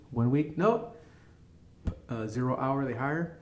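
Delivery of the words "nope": high, rising one-word brush-off; curt rejection. Retold or imitated. Hiring office answering an applicant's call, high-pitched brush-off. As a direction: pop it up high to dismiss the caller.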